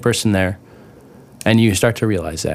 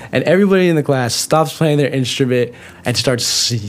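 ordinary talk

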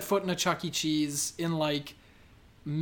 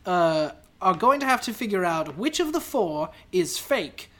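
lightly hammed comic character voice; character voice drifting toward normal; Juice Chuggins; 6 semitones higher, 5 dB louder than usual. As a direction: explain rules in a raised put-on voice. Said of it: "I'm Juice Chuggins".

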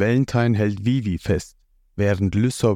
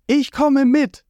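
Vivi's voice; 4 dB louder, slower than usual. A narrator's reading. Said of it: "Vivi sagt".

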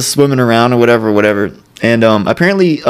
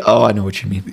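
normal talking voice

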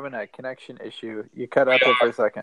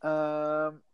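usual speaking voice

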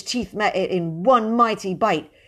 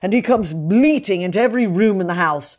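raised, aggrieved moan; moaning complaint; 6 semitones higher, 5 dB louder than usual. A narrator's reading. Mr Brown moans to William about the guest, raised and aggrieved. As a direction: whine about being hunted in your own house. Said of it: "he moaned".

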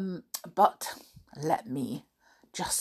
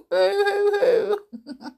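high, slow hammed-up boo hoo; fake crying; 13 semitones higher, slower than usual. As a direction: pretend to sob, high and slow. Mock sobbing about work.

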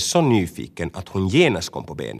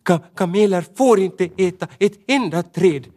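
much higher, stammering but determined; small rabbit standing her ground; a touch of stubborn resolve; firmly; 11 semitones higher, 4 dB louder than usual. Acted. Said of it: "sa hon bestämt".

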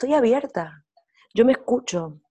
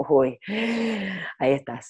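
conscience voice. Retold or imitated. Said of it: "mi conciencia dijo".

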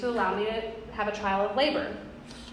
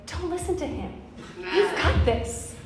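reassuring nurse; 5 semitones higher, 4 dB quieter than usual. Retold or imitated. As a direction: comfort a scared mother in labor. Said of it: "they would hold my hand and they would say".

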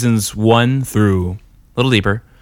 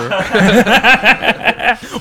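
high burst of laughter; 9 semitones higher than usual. Real, not acted. Laughing at the bit.

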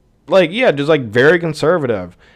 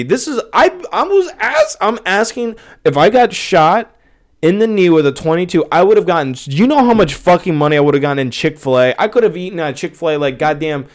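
indignant rant; 5 semitones higher than usual. Real, not acted. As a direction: demand an answer from the world. Continued outraged rant.

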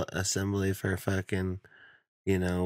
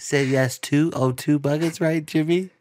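higher, louder coaxing parent; pushy parent; 5 semitones higher, 5 dB louder than usual. Acted. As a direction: prod the kid along like an eager dad. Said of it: "parent that's standing right behind you".

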